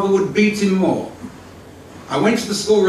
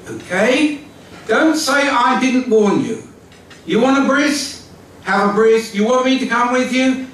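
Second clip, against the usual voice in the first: retold exasperated father giving in, higher and a bit louder; fed-up dad conceding, pitched up and raised; 6 semitones higher, 4 dB louder than usual. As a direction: throw up your hands and give in. Father finally gives in, exasperated.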